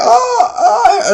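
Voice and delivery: high squealing oh oh through a laugh; mock gasp. Fan reacting to the kiss, high laughing squeal. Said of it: "And like".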